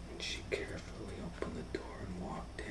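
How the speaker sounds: hushed whisper as she creeps in; whisper, tiptoeing through the words; whispered story narration. A narrator's reading.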